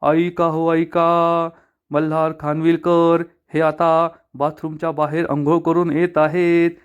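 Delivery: slow, drawn-out public crier call; mock announcement, stretched and formal; town crier announcement. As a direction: proclaim it slowly like a village herald. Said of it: "मी जोरात ओरडणार".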